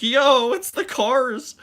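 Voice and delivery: high, slow, gushing fan squeal; hyped vlogger voice. Pretend. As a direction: gush like an amazed theme park vlogger. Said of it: "make shorts while I'm in Cars Land and be like".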